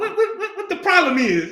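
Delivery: high-pitched, stammering mock protest; David's exasperated comeback. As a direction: sputter it high, half laughing. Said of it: "that's how we would say it today".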